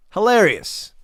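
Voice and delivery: a high, loud sarcastic jab. Real, not acted. Vlogger mocking Tate's crying spiral, sarcastic. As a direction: toss it off dripping with sarcasm. Sarcastic comment on his breakdown.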